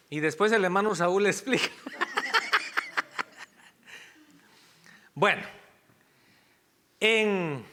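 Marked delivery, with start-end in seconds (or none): 1.7-3.2 breathy staccato laugh. Real, not acted. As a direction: laugh in quick, airy bursts after a joke. Joking aside about brother Saúl.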